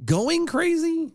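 high, slow disbelieving echo; incredulous. Real, not acted. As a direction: stretch it out in disbelief.

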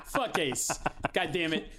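ordinary talk

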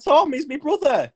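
high-pitched mock insistence; English dude. English dude explaining to his attacker, mock pleading. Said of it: "And he's like".

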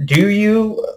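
a pointed, high sarcastic jab; sarcastic challenge. Retold or imitated. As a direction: throw the question back in mock disbelief. Sarcastic reply to a know-it-all.

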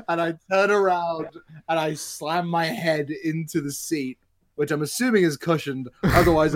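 ordinary talk, nothing marked

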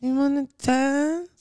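high, slow imitated stripper voice; girl voice imitation. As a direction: draw it out in a put-on girly voice. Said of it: "I can't talk like a girl".

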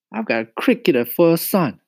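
high, loud motherly complaint; exasperated mama. A narrator's reading. Mama grumbles about her cricket-talking son, exasperated.

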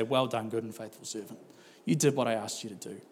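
low, hushed words of approval; tender commendation. Acted. God to the preacher at the end of time, tender commendation. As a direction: drop soft and low, like a quiet blessing.

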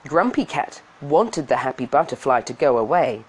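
usual speaking voice

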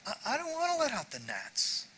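soft whiny grumble. Retold or imitated. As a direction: whine it under your breath. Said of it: "perhaps he was using his whiny voice".

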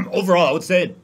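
choking on saliva mid-sentence. Real, not acted. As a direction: push words past a choking throat. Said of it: "I'm choking on myself".

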